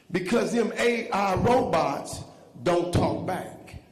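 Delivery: loud, high sermon rhythm; preaching. Real, not acted. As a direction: preach it at full force. Preaching at full force.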